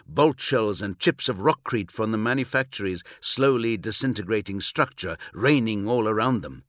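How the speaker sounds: plain reading voice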